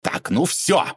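mild shout of lost patience; shouting back. A narrator's reading. Man ending the argument with the lady, mildly shouted. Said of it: "закричал и Бобров".

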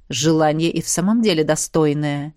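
low calm male voice; a measured warrior; swordsman voice. A narrator's reading. (низкий голос мечника)

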